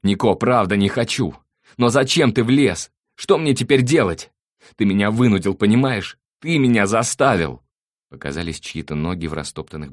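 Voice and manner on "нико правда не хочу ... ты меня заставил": loud, high-pitched desperate wail; frantic, tearful accusation; impassioned cry. A narrator's reading. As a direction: shout the blame back in anguish. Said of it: "страстно вскрикнул двоюродный".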